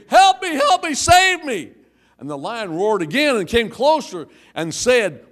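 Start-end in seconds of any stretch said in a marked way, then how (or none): 0.1-1.7 high, loud panicked scream; hammed-up screaming for help; mock scream. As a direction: shriek for rescue like a terrified man. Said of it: "and the man screamed".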